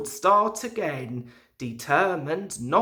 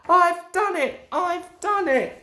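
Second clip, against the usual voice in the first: much higher, triumphant I've done it; high triumphant engine; triumphant puffing; 11 semitones higher, 4 dB louder than usual. A narrator's reading. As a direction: cheer it at the top. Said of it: "he puffed triumphantly".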